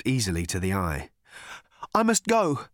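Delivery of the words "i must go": insisting. A narrator's reading. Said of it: "insisted Arthur".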